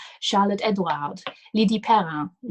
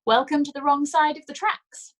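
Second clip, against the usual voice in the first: brisk; 8 semitones higher, 5 dB louder than usual. A narrator's reading. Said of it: "she said briskly".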